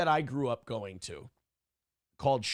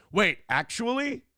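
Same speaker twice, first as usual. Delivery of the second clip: put-on higher, wide-eyed kid asking; son's gullible line; 7 semitones higher than usual. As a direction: sound like a kid who believes it all. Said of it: "And he'll go".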